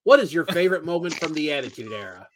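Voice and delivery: normal talking voice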